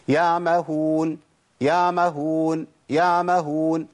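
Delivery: يعمهون said as yamahun, with the ع dropped entirely; نطق خاطئ بإسقاط العين; wrong reading, no ain. Shown as a demonstration. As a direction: recite يعمهون swallowing the ع. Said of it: "أنا كده ما جبتش عين خالص".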